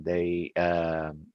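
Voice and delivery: normal talking voice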